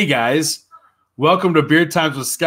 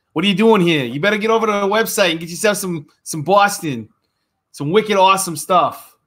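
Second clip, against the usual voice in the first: put-on a light Boston accent, a bit louder and higher; hammed-up Boston sales pitch; pitching the website in a put-on Boston accent; mock Boston accent; 6 semitones higher, 3 dB louder than usual. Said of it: "Thought my Boston accent was bad?".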